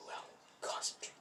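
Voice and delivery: whispered, drawn inward; barely audible private reminder; hushed self-talk. Retold or imitated. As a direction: whisper it as a note to yourself. Deku's self-talk in retold fight.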